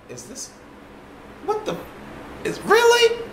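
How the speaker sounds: can't believe what you're seeing; retelling seeing women plank in a parking lot, squeaky high disbelief; stunned disbelief. Retold or imitated. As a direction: voice shoots way up in disbelief. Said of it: "I'm like".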